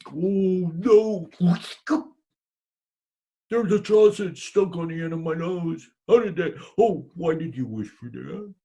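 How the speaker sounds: loud, high alarmed cry; husband horrified, blaming his wife; dismayed woodcutter. Acted. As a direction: panic at the sausage on your nose. Woodcutter, sausage on nose.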